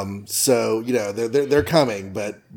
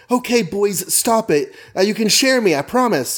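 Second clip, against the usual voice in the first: high, light mock-girlish pleading; playing Liz calming the boys in a raised voice; girly voice; 8 semitones higher than usual. Teen girl soothing two fighting boys, high mock voice.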